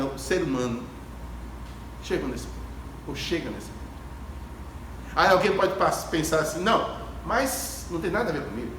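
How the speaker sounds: ordinary talk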